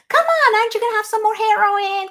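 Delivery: high, loud, rapid coaxing; mock pushy guest. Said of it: "Birthday party".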